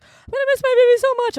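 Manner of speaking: high, put-on sappy voice; mock a friend getting weepy; exaggerated high-pitched pining; imitating sentimental friend. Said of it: "one of my friends was like".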